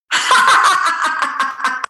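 bursts into a high giggle; giggling at her own silliness; real laugh. Real, not acted. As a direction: let a real laugh bubble out. Breaks into laughter before silly part.

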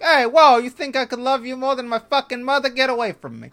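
very high, loud hammed-up wiseguy; offended Italian guy.

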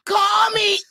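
high, giggly squealed invitation; playful plea. Pretend. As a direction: call out high, hamming up the sponsor pitch. Repeats joking plea to sponsors.

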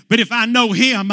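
usual speaking voice

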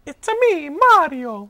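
very high cartoon Italian plumber, ringing out; high-pitched, exuberant Italian game voice; Mario. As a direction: imitate a squeaky video game mascot. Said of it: "then Mario started talking".

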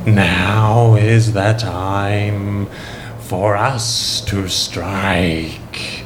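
very slow, low, strange sinister voice; sinister cult leader. Acted. Cult leader addressing his followers, slow sinister voice. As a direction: drag each word out like a villain. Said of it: "in a strange and sinister voice".

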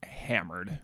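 hushed whisper on hammered. Real, not acted. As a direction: breathe it out as a secret. Confiding drunk night.

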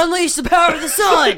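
booming ad announcer shout; energy drink ad. Acted. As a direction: shout it like a TV commercial. Sunny D ad slogan shouted.

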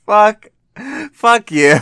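loud laughing curse; loud mock outrage. Real, not acted. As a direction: shout it through laughter.

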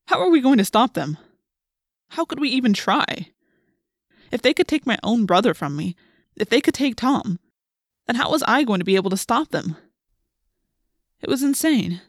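overwhelmed and despairing; distraught. A narrator's reading. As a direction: plead helplessly as it sinks in. Narrator despairing over the brother's takeover.

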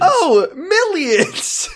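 slow, drawn-out sarcastic oh; mock being impressed; mocking echo. Real, not acted.

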